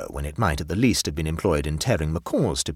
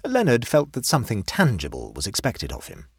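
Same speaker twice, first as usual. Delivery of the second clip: ordinary delivery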